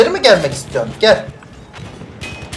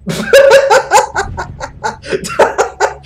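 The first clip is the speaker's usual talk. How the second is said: high burst of laughter; 12 semitones higher than usual. Real, not acted. Ölünce kahkaha. (ölünce yüksek kahkaha)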